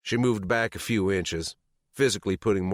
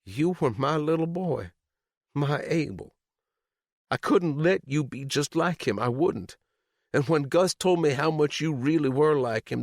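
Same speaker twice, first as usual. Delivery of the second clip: tearful; 10 semitones higher than usual. A narrator's reading. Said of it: "her eyes glassy".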